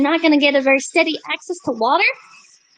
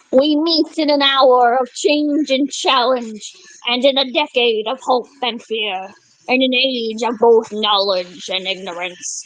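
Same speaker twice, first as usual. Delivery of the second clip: slow, low oratorical delivery; formal speech reading; 7 semitones lower, slower than usual. Acted. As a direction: read it like a grand speech. Reads JFK moon speech.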